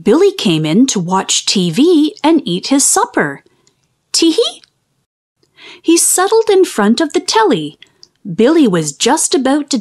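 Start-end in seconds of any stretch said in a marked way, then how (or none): 4.1-4.6 tiny high tee-hee, rising; a sneaky, high-pitched titter; mischievous giggle. A narrator's reading. Spider giggles, plotting a trick.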